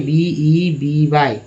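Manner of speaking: letters spelled out one by one, slowly; slow letter-by-letter spelling. Shown as a demonstration. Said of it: "baby".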